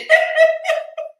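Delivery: loud high shriek of laughter. Real, not acted. Laughing about revving the engine, loud shriek.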